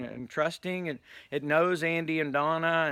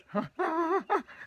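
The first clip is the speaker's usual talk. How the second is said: high, rapid imitated gasping; panicked cat gasps; 4 dB quieter than usual. Storyteller playing the cat scared by the mask, high frantic gasps. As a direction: gasp in mounting animal panic. Imitating the frightened cat's last breaths.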